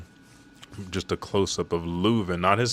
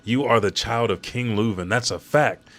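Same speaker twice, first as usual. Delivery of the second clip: louder, higher insistent yell; 4 semitones higher, 4 dB louder than usual. Retold or imitated. Brook yells while towering over Shuri. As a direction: shout her words back at her. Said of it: "Brook yelling".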